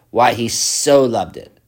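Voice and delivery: "so" stretched and emphasized, slow; stress on so. Shown as a demonstration. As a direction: press the word "so". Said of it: "The one is the particle so".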